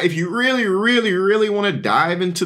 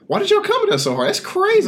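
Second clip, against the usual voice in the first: mock outrage; faster than usual.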